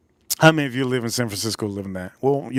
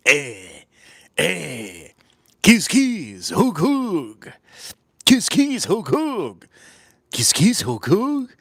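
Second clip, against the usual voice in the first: imitating how he talks; 3 semitones higher, much slower than usual. Streamer mimicking how someone talks, exaggerated put-on voice. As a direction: punch each repeated word in a put-on voice. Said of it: "That's how he talks".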